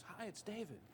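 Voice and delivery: high, lilting imitation of a caller. Famous singer phones the guitarist, high lilting imitation. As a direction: pitch it way up, like a cheery mock phone hello. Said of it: "his voice goes".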